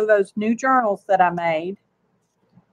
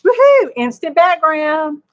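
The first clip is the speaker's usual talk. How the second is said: real delighted outburst, pitched up; gleeful cheer; 6 semitones higher, 4 dB louder than usual. Real, not acted. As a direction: celebrate a little craft success. Cheering her finished background.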